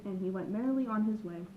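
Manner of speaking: plain reading voice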